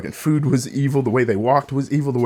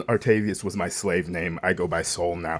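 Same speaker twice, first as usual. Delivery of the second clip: ordinary delivery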